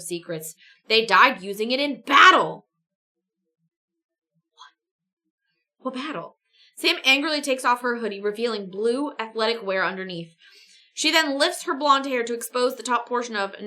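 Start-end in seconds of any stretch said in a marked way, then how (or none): none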